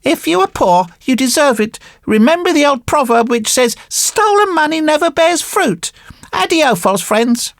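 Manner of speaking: high-pitched puppet voice, brushing them off; dismissive marionette. Acted. Marionette rebuffs the false friends, high and dismissive.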